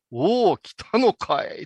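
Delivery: slow, lightly voiced elder; a hint of a lofty old priest; condescending old monk. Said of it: "すっごい上から目線でさ".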